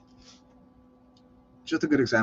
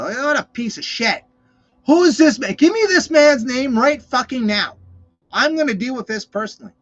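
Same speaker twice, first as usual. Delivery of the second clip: loud, raised furious demand; furious king; 8 semitones higher, 6 dB louder than usual. Retold or imitated. David hearing the story, a furious shout. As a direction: bellow for the man's name, slowing on the threat. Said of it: "David was fucking furious".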